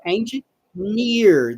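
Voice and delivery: "engineer" slowly, long EER at the end; long final vowel. Shown as a demonstration. Teacher modeling engineer's long ending. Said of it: "This is a longer sound here at the end".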